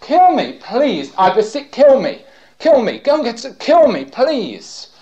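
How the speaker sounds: high, loud begging, kill punched each time; crying out, begging. Retold or imitated. Crucified men pleading with soldiers, loud begging. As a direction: plead to be killed, loud and desperate. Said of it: "cried out and said".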